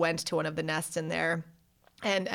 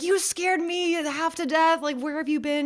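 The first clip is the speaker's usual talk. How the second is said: scolding mom; 10 semitones higher than usual. Retold or imitated.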